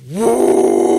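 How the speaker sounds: loud startled whoop. Retold or imitated. Swerving past the cone, a loud whoop. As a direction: let out a big relieved woo. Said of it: "and I was like".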